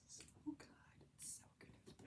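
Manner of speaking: soft, voiceless, dreamy praise; hushed whisper. Real, not acted. To viewers about Good Spirits, dreamy whisper. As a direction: whisper it like a secret treasure.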